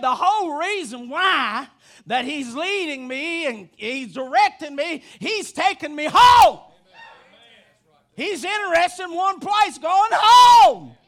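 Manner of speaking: preaching that climbs to loud, high shouts; full-force preaching. Real, not acted. Preacher declaring to the flock he's going home, rising shouted preaching. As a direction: build each phrase up to a shouted ending.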